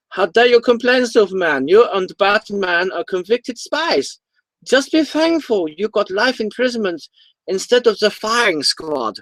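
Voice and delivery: put-on high-pitched German officer accent. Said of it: "I'm gonna do it in a German accent".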